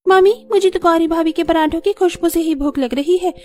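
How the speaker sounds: teasing sister-in-law. Acted. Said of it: "हंसते हुए आती है".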